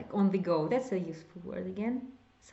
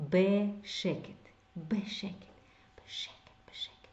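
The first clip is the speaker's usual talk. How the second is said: from plain voice down to a whisper; בשקט repeated, fading into a whisper; 4 dB quieter than usual. Shown as a demonstration. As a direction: let each בשקט fade until it is whispered. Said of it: "Quietly in a low voice is in quiet".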